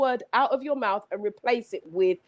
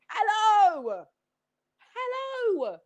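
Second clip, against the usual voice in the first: excited hello; 9 semitones higher, much slower than usual. Real, not acted.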